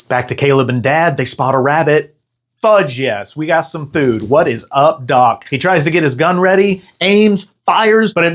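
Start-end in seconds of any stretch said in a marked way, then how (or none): none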